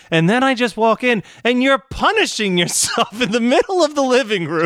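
high-pitched imitation of an outraged mother; high mock-scolding mom voice; George's mom. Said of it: "and she's just like".

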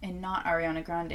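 ordinary delivery

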